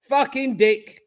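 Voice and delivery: high, cursing fan frustration; exasperated outburst. Real, not acted. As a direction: swear at the player who just missed. Reacting to a missed chance.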